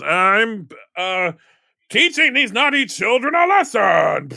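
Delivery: high, loud gloating Krampus; gleeful Krampus. Acted. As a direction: gloat like a monster punishing naughty kids. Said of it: "And he goes".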